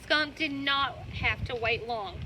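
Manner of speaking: plain reading voice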